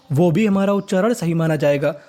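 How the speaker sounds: ordinary talk, nothing marked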